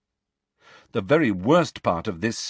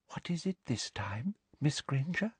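hushed teacher's whisper with a higher lilt; 5 semitones higher, 9 dB quieter than usual. A narrator's reading. Teacher bends to a pupil so the class cannot hear, whispered. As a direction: lean in and whisper it privately. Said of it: "she bent down and whispered".

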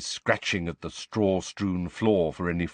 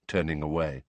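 ordinary delivery

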